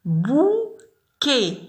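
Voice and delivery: Bouquet high and careful, ending on é; clear demo. Shown as a demonstration. Teacher introduces bouquet to the class. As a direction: say Bouquet with a bright final é. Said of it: "أو تي تقرأ إي".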